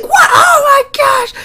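shrieking, very high, loud mock scream; over-the-top falsetto shriek; imitating Markiplier's scream. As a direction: scream it like a jumpscare hit. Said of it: "he's like".

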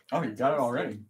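ordinary delivery

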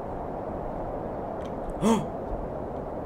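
scared gasp. Real, not acted. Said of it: "Don't jump scare me".

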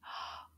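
soft quick gasp; hushed intake of discovery; surprised gasp. Pretend. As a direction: gasp at the new picture. Reveals night picture.

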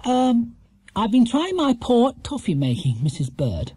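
put-on high-pitched, mildly sad young bear; sad explanation. A narrator's reading. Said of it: "explained Paddington sadly".

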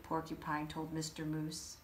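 plain reading voice

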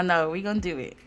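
normal talking voice

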